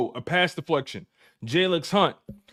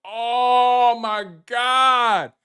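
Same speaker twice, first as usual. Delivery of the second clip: drawn-out, raised cry of amazement; astonished exclamation; 3 semitones higher, 3 dB louder, much slower than usual. Real, not acted. Host hearing of a friend's big payout, astonished. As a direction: stretch it out in disbelief. Reacts to huge parlay payout.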